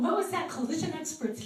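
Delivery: curious and thrilled; high, quick, astonished asking; eager disbelief. Retold or imitated. Woman intrigued.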